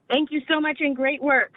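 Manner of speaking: ordinary delivery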